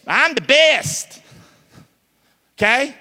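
slow, high, smug boast; mock braggart. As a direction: play the show-off who thinks he's best. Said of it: "you think you the best".

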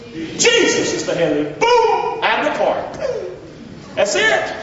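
excited preacher cry; triumphant exclamation. Real, not acted. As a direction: shout it like a home run.

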